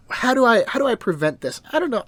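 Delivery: high, loud flustered questioning; imitating a heroine at a loss; mock flustered. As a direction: puzzle aloud in a hammed-up fluster. Acted heroine's anxious self-questioning.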